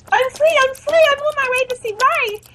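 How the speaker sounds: gleeful. Acted. Escaped character exults.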